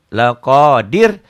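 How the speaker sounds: "laqadir" with held "qa" and short "dir"; slow loud "laqadir", ending short; short closing syllable. Shown as a demonstration. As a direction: chant "laqadir" loudly, not stretching "dir". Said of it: "Kenapa nggak pajak?".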